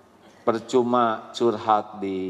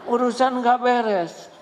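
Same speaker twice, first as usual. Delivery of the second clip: mock exasperation; 12 semitones higher, slower than usual. Jealous wife's mess mocked.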